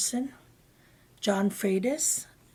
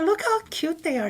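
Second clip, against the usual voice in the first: put-on much higher, cooing baby-talk; cooing over seals; 9 semitones higher, 5 dB louder than usual. Wife cooing at the seals beside her husband, high and sweet. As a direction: coo at them like they're puppies. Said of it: "And I said".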